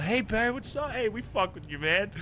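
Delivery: imitating the Saints being overly chummy; playful impression of a gang member saying hello; mock friendly greeting. Gang members greeting him warmly.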